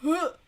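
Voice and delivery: high, loud "ugh"; sharp burst of revulsion; disgusted groan. Real, not acted. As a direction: shudder it out in disgust. Disgust after mocking the heroine.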